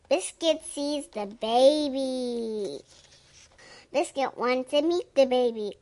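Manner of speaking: ordinary delivery, nothing marked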